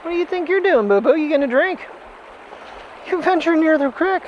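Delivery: pet voice. Real, not acted. Talking to dog on trail.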